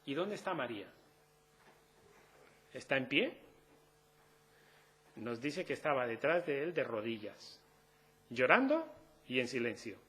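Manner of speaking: ordinary delivery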